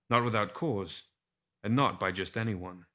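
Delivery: usual speaking voice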